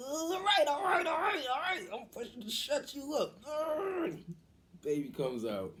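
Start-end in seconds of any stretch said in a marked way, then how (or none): 0.1-4.2 high pained wail; hammed-up exasperated labor cry; mock labor straining. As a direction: wail it while bearing down. Mother straining and snapping, acted.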